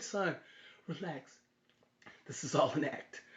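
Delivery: low, very soft aside; calm the child under your breath; hushed reassurance. Retold or imitated. Father quietly calms frightened son.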